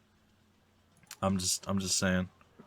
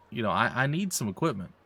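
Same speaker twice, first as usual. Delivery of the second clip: usual speaking voice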